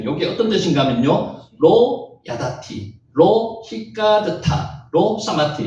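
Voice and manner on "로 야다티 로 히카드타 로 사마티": "로 야다티, 로 히카드타, 로 사마티" in slow, measured rhythm; "로 야다티, 로 히카드타, 로 사마티" slowly, in three parallel phrases; rhythmic recitation. Shown as a demonstration. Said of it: "이것을 읽으면 운율이 있습니다".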